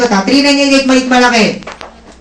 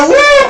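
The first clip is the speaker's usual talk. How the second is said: very high, loud whoop with a laugh; cheering woo; 4 dB louder than usual. Retold or imitated. Guest going home still cheering, high loud whoop. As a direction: cheer out joyfully. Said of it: "dun sa".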